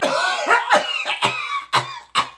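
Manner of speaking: long, very high hacking; violent coughing fit. Acted. Sick boy shown to nurse as fine, hacking violently. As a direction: cough and hack till breathless.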